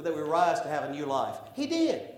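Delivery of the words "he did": high, punchy answer; triumphant reply. Acted. Triumphant answer in sermon refrain.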